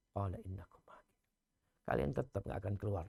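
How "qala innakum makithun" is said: hushed answer, breath only; whispered verse. Acted. Preacher whispers the angel's reply to the congregation, hushed. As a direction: whisper the verdict.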